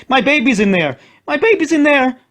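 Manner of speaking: a mother's frantic screams. A narrator's reading. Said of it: "started to scream again".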